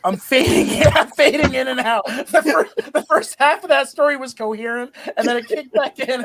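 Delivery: high, loud, laugh-filled explaining; giggly confession. Real, not acted. Stoned storyteller admitting he's fading in and out, giggly. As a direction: explain it while cracking up.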